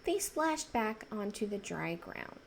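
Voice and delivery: plain reading voice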